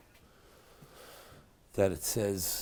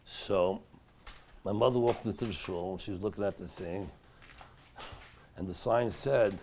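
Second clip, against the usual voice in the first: ordinary delivery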